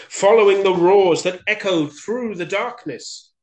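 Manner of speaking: loud, high, dramatic storytelling; urgent narration. A narrator's reading. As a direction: raise your voice for the chase. Mouse races to rescue.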